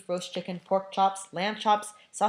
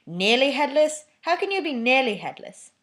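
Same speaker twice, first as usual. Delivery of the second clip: astonished; 8 semitones higher, 9 dB louder than usual. Acted. Seamus astonished question.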